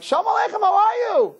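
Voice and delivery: high-pitched retold greeting; insistent greeting. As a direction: push the greeting up high, undeterred. Repeats his greeting to sullen Howie.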